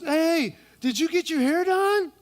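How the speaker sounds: high, gushing woman voice; mock-girly compliment; lightly hammed-up chatty woman; woman number one. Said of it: "she goes".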